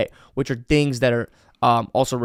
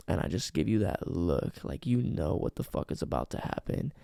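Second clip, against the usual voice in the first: low, soft, nearly unvoiced; hushed dirty talk; 6 semitones lower, 7 dB quieter than usual. Shown as a demonstration. Coach shows an open-ended voice memo, low and hushed. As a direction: leave her hanging in a hushed voice. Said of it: "by saying something like".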